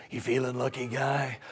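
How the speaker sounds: low, quiet movie tough guy; Dirty Harry. Acted. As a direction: drop it low and soft, menacing gunman impression. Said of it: "that part in the Dirty Harry movie".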